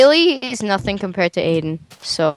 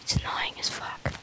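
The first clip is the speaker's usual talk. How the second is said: breathy whispered aside; whispering; 15 dB quieter than usual. Real, not acted. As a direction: drop to a whisper. Losing his voice, aside.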